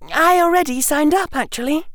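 put-on high-pitched girl's voice, a little reticent; small, high Hermione voice; a little quiet. Hermione admitting to Harry she signed up, a little quiet. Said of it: "her voice a little quiet".

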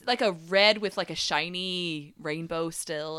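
ordinary talk, nothing marked

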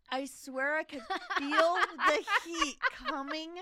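high, loud words through laughter; really cracking up at your own story; laughing while talking. Real, not acted. Prankster recalling the caller's anger, laughing hard.

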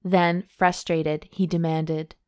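steady read-aloud storytelling voice; romance story narration. A narrator's reading. Host reading a steamy novel to her cohost, narration voice. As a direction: read the love scene out like an audiobook.

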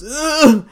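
high, loud comic "ugh"; pained grunt. Acted. Assassin knocked over by the hero, high loud grunt. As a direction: groan as if just knocked down.

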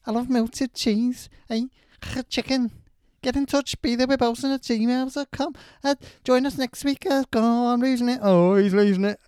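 put-on loud, high Scouse accent. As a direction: Liverpool Scouse voice shouting the sign-off. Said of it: "schedule with Scouse accent".

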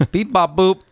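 high playful robot beep-bop-boop. Pretend. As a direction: chirp it like a toy robot. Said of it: "robot voice".

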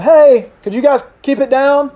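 high, tentative asking; mock polite request. Jesus supposedly asking the storm nicely, high mock request. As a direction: ask it like a meek neighbour. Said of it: "he didn't ask the storm".

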